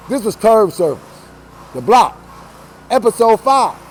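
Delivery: loud host sign-off; show outro. Acted. Host closing out the episode, loud and proud.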